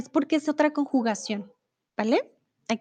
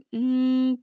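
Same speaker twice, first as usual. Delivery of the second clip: ordinary delivery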